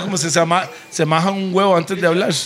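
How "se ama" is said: ordinary delivery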